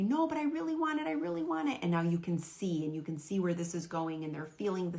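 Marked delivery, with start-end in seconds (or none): 0.0-1.8 put-on high, pleading little-kid whine, a bit louder; whiny child. Child whining at the parent for the toy, high and pleading. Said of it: "And then they say".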